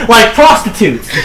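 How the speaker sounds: laughing while repeating it, slowly; giggling through the words. Real, not acted.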